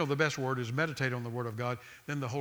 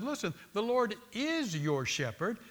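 slow, higher, emphatic correction; firm retelling; 8 semitones higher, slower than usual. Retold or imitated. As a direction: set the complainer straight, slowly. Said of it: "I said".

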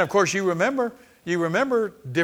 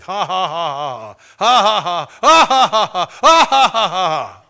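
deliberate "ha ha ha", building louder; imitated laugh done in faith; 2 semitones higher, 9 dB louder, slower than usual. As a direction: force the laugh out in growing bursts. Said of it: "not laughing hysterically like the Holy Ghost, just doing it".

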